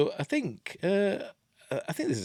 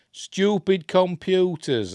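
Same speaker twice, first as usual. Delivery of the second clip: louder, higher, drawn-out irritation; exasperated outburst; 9 semitones higher, 6 dB louder, slower than usual. Real, not acted. Presenter cursing computers that won't skip, loud exasperation. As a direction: blame the machine, slowly and loudly.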